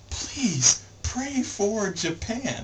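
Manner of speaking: high, slow, laughing plea; mock solemn plea. Takashi pleading with friends about the rock, slow laughing plea. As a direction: plead grandly through a laugh. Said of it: "And he's like".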